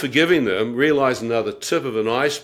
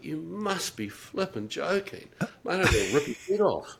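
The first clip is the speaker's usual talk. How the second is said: mock rage; 5 semitones higher, 8 dB quieter than usual. Rage at taxi driver retold.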